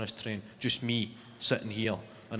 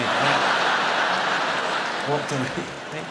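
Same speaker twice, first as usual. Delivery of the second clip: laughing; 4 dB louder, much slower than usual. Real, not acted. Laughing at own joke setup.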